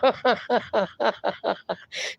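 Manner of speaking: soft trailing string of giggles; real soft laugh. Real, not acted. Host laughing at a viewer's hips pun, softly. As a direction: let a quiet laugh roll out.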